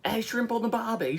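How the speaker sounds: high-pitched mock Aussie accent. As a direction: put on a squeaky caricature of an Australian. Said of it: "do the Australian accent, like the stereotypical one".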